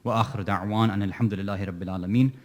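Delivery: low, very fast formula prayer; quick ritual Arabic recitation; rapid dua recitation. Acted. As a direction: rattle off the closing prayer. Closing formula.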